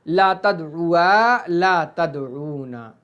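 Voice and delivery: drawn-out chant of verb forms; slow drilled recitation. Shown as a demonstration. Teacher drilling the class, slow and drawn out. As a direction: recite each form slowly. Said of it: "reciting forms".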